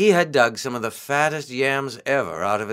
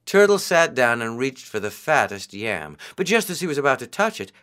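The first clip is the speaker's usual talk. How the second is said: ordinary delivery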